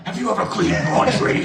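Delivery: loud high laughing jab; laughing shout. Real, not acted. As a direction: yell it through laughter. Mocking retort, shouted laughing.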